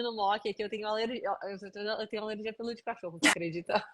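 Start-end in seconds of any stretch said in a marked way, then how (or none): 3.2-3.9 bursting into real laughter; laughing. Real, not acted. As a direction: laugh out loud at the irony. Laughing at her own dog allergy. (rindo alto)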